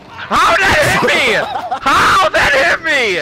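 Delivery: high, slow, shouted disbelief; raging gamer. Real, not acted. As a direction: yell it out, half laughing. Raging at the jockey hit.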